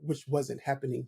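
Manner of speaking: usual speaking voice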